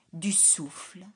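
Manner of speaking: ordinary delivery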